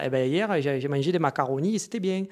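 put-on playful Marseille accent. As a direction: put on a Marseille accent for a loudspeaker phone call. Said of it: "j'ai fait l'accent marseillais".

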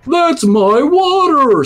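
high, slow, pleased fish voice; drawn out like a happy fish spotting home; fish voice, delighted. Retold or imitated. Fish finding his lake, high and slow. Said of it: "says Mister Fish".

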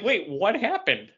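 high, slow baffled wait what happened; mock bewildered question; confused surprise. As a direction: sound lost and confused. Retelling his confused reaction.

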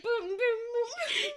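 slow, high-pitched booming drum noises; imitated drum banging. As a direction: boom it out like an over-keen drummer. Imitating the girl banging drums.

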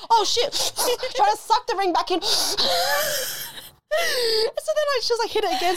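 high giggly retelling through laughter; cracking up, voice squeaking high; laughing. Real, not acted. Laughing at blowing out the medicine, high giggles.

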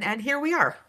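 high, breaking into real laughter; laughing. Real, not acted. Cohost laughing that all her theories were wrong. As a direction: laugh at your own wrong guesses.

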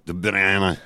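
"De Bruyne" in a put-on Flemish accent; affected Flemish accent. Shown as a demonstration. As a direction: say it the Dutch Flemish way, exaggerated. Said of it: "adopt an affected Dutch Flemish accent and go".

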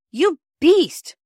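loud, high burst of indignation; tied-up boy exploding in outrage; outraged shout. A narrator's reading. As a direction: yell it as a furious accusation. Tied-up Peter rages at his sister.

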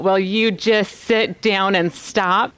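ordinary delivery

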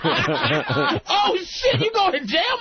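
high, hammed-up laughing disbelief; acted laughing. Extra told to laugh at the actor, hammed-up laughter. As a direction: crack up mockingly through the words. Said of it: "Nah, just laugh".

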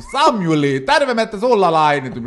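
loud mock-Finnish father's call; shouting through letterbox. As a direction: holler through the door for your son. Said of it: "Så han börjar ropa i brevinkastet".

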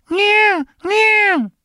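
very high squeaky cat meows, falling; tiny falling kitten mews. Retold or imitated. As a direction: mimic the sneezy cat's little meow. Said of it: "then it goes every once in a while".

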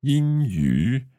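英语 with a level then dipping tone; tone demo. Shown as a demonstration. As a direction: hold 英 high and level, then dip on 语. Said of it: "first tone and then a third tone".

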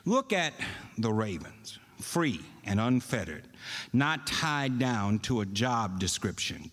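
deliberate reading with long pauses for emphasis; slow, emphatic reading. Shown as a demonstration. Preacher reading scripture to congregation for emphasis, slow and emphatic. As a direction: read scripture slowly, weighing each phrase. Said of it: "I know I read that slow".

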